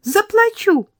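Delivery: high, loud bright cry of Заплачу; girl proudly shouting she'll pay; eager exclaim. Acted. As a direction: blurt out Заплачу with glee.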